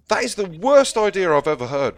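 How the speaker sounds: high, loud exclamation of disbelief; appalled. Acted. Casting boss reacts to pulling a number from a bag, appalled. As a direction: dismiss the idea with loud scorn.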